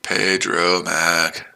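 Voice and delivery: slow, hushed savouring of "Pedro Mac"; drawn-out mock naming. Trader toys with rival's name, long and soft.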